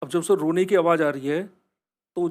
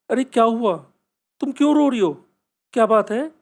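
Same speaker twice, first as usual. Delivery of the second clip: higher and louder, alarmed; startled concern; 5 semitones higher, 4 dB louder than usual. Retold or imitated. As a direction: rush to ask the crying girl what's wrong. Tanvi finds crying girl.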